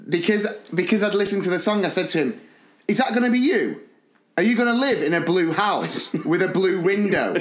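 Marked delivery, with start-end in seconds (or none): 2.9-7.3 high, insistent questioning of a pupil; mock interrogation. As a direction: press the child with comically dramatic questions. Mock-warning a child, song lyrics.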